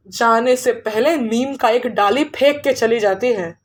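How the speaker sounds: ordinary delivery, nothing marked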